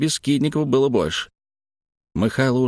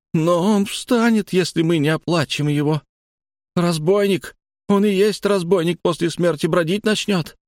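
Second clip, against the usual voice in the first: fearful pleading; 7 semitones higher than usual. Acted. Frightened old woman pleads.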